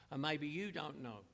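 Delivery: ordinary talk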